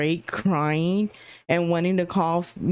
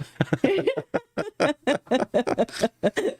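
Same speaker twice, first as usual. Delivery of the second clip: embarrassed laugh; 6 semitones higher than usual. Real, not acted. Embarrassed about the video.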